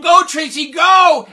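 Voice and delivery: loud high shouting cheer for a runner. Retold or imitated. Crowd in the bleachers urging Tracy to run, shouting. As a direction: yell it from the bleachers.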